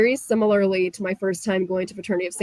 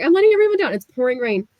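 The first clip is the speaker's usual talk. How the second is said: retold crying; 5 semitones higher, 3 dB louder, faster than usual. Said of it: "I'm just crying".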